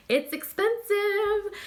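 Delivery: very high, slow squeal on the words; mock price shock. Reviewer wincing at the bottle price for viewers, high mock squeal. Reacting to a 580 dollar price.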